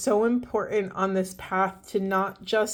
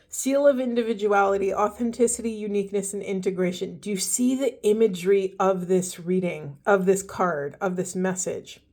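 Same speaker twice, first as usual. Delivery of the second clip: ordinary talk, nothing marked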